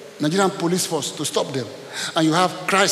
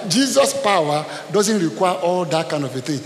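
ordinary delivery

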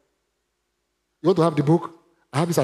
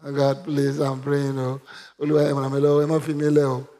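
imitated monotone begging prayer; mock pleading prayer. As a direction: mimic someone praying helplessly. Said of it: "not praying about it".

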